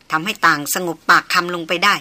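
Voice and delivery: ordinary delivery, nothing marked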